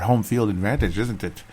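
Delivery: normal talking voice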